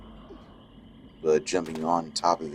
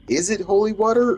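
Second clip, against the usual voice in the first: incredulous; 4 dB louder than usual. Real, not acted. Mock puzzled at swamp water.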